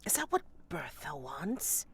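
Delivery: soft whisper; whispered aside. Acted. Player whispering to Sepsis aside. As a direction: keep it secret, whisper.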